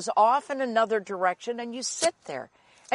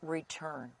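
much softer and lower, set apart; quiet, low emphasis on one word; hushed key word; 4 semitones lower, 13 dB quieter than usual. Shown as a demonstration. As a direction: drop right down for weight. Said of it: "I want you to get this".

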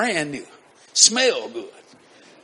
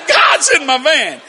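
excited shout; 4 semitones higher, 7 dB louder, slower than usual. Retold or imitated. Repeats to trooper.

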